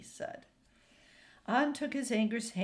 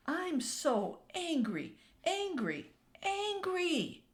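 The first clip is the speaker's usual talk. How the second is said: each angry climbing higher; building anger; 9 semitones higher, slower than usual. A narrator's reading. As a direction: wail it, rising each time. An rages alone in his room.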